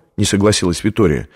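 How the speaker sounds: ordinary delivery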